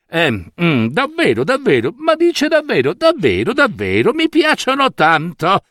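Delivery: high, fluttering repeated assurance; overeager minister. A narrator's reading. Old minister assuring the weavers he loves the cloth, high and overeager. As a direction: insist too much, voice climbing. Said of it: "disse il vecchio ministro".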